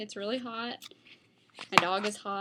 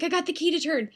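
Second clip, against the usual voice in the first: higher, louder, proud announcement; excited Laura quote; 6 semitones higher, 7 dB louder, faster than usual. Retold or imitated. Laura telling her friend she got the key to turn, excited. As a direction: call it out like a small win. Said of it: "Laura's like".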